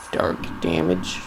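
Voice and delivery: low, slow, mock-ominous; deep voice. Deadpan low delivery.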